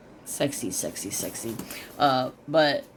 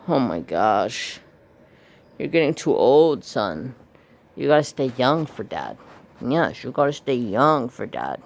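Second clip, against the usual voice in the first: louder, fond praise to the dog; tender pet talk; 4 dB louder than usual. Real, not acted. Loving talk to old dog.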